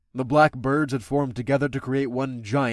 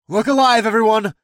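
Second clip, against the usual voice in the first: loud, high rallying call; rally the crew; commanding shout; 9 semitones higher, 9 dB louder than usual. Acted. Captain warning her crewmates, loud command. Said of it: "I commanded all of my crewmates".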